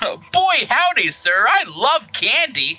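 put-on high, slow gullible kid; innocent kid voice. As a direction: gush like a naive child. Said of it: "And I'm just like".

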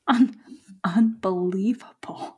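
slow, fed-up whisper; exasperated whisper. Acted. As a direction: breathe it out, fed up. Exasperated wife.

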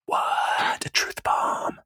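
drawn-out, unvoiced mock awe; mock amazement. Podcaster reacting to a silly insight, mock awe. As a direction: whisper it very slowly, as if blown away.

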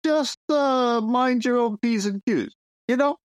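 a New York mobster leaning on a monk; New York mobster accent. Pretend. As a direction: lean on the New York wise-guy accent like a shakedown. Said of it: "not appreciating our accents".

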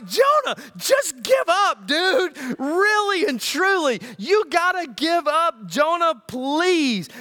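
high, loud, drawn-out begging; exasperated plea. Acted. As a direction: implore a stubborn friend to give up. Imagined exasperated plea to Jonah.

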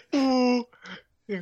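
high, slow Piggy with Scottish air; McPiggy voice. Retold or imitated. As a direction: swoon through the Scottish moors. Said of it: "I love McPiggy".

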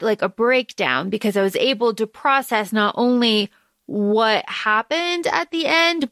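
usual speaking voice

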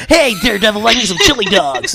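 hint of a cocky Sonic; playful mock Sonic impression. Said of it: "Iron Fist has your Sonic voice".

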